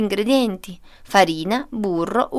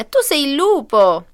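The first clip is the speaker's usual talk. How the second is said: loud, slow, raised accusation; little girl's shriek; 4 semitones higher, 5 dB louder than usual. A narrator's reading. Little girl points out the wolf, loud cry. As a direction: cry it out, stretched and high. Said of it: "strillò la bambina".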